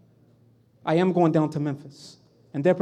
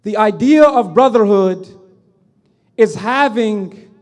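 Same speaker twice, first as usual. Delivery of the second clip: preaching at full force; 9 dB louder than usual. Acted. Preacher to the congregation on brotherhood, loud fervent preaching. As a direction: drive home brotherhood from the pulpit.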